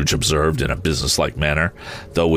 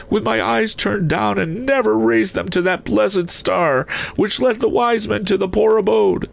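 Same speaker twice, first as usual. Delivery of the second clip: very high, impassioned spectral voice; anguished ghost; 14 semitones higher than usual. A narrator's reading. Ghost rebuking himself before Scrooge, anguished high wail. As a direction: preach it high, wailing with remorse.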